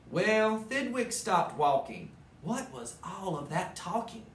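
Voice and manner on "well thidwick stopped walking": usual speaking voice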